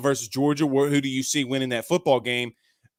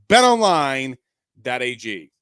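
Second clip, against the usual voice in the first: emphatic sponsor tag; 6 dB louder, slower than usual. Real, not acted. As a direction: punch out BET ONLINE as an ad sign-off. Sponsor tag repeated louder to close.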